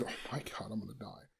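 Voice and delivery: hushed, breathy dread; barely audible panic; muttered fear; terrified whisper. Retold or imitated. Panicked quote retold, very quiet.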